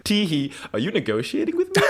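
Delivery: high, giggly mock disbelief; tickled, teasing a bold little girl; amused Elijah. Elijah amused that Elena bargains, high giggly tease. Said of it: "And Elijah's like".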